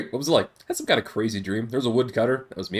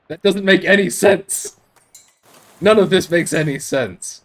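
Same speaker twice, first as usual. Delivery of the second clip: exasperated; 5 semitones higher, 5 dB louder, slower than usual. Real, not acted. Frustrated at nonsense chess.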